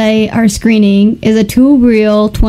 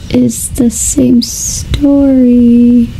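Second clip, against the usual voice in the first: slow, drawn-out dawning realisation; stretched-out words of someone slowly catching on; 2 semitones higher, much slower than usual. Retold or imitated. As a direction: let it sink in word by word, very slowly. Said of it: "Robert's face is like".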